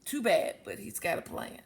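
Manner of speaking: plain reading voice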